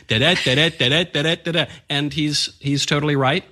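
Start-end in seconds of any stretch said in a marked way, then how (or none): 0.1-1.7 light mocking singsong syllables; sing-songy preschool teacher. Commentator mimicking the lady Trump described, sing-songy teacher. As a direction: bounce it along like a nursery chant. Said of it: "sounds like a sing-songy preschool teacher".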